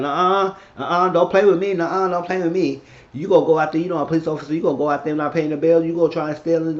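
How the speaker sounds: high, insistent telling-off; scolding refusal. Retold or imitated. Confronting someone over an unpaid bill, scolding.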